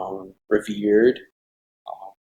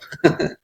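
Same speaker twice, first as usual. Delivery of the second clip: short laugh; 8 semitones higher than usual. Real, not acted. Laughs after saying goal.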